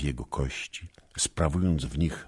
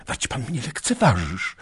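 put-on indignant girl; 10 semitones higher, 4 dB louder than usual. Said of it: "spytała, dysząc mocno".

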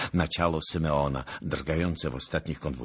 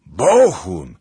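loud, sharp cry of a name; warning shout; 3 semitones higher, 16 dB louder than usual. A narrator's reading. Someone calling out to Bohun, loud warning shout.

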